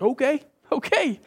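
high startled okay, okay; freaked-out okay. Retold or imitated. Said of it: "I'm freaking out".

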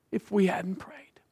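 slow whispered question. Real, not acted. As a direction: drop to a hushed whisper. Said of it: "sermon climax, whispered".

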